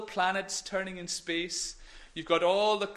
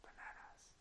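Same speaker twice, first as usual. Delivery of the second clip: faint whisper; 26 dB quieter than usual. Shown as a demonstration. Stepping back from the microphone for the children: faint whisper. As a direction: whisper from a step back, very soft. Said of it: "I'll maybe say it even quieter".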